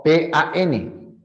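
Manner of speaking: "P A N" given as three separate sounds; each sound of "P A N" slow and distinct. Shown as a demonstration. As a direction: pull "P A N" apart into its three sounds. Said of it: "hay tres sonidos".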